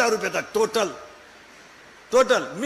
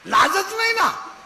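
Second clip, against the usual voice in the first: scornful cry; 9 semitones higher, slower than usual. Real, not acted. Preacher mocking shameless girls.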